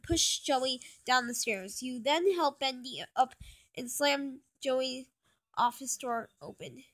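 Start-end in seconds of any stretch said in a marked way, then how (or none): none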